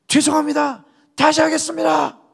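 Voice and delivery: high, loud dogged reply; determined recruit. Retold or imitated. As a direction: answer like a recruit who won't quit. Recruit answering instructor. (훈련병이 조교에게 굽히지 않고 대답하는 목소리)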